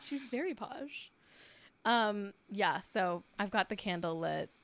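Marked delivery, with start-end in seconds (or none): 0.0-1.1 soft, slow mock-posh British accent; put-on posh British. Host echoing the co-host about Cara, soft mock-posh British. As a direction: draw it out in playful posh British.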